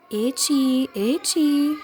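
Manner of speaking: high calling cry to the cat. A narrator's reading. Little witch calling to her frozen cat, high cry. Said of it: "cried the little witch".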